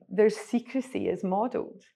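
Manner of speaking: ordinary talk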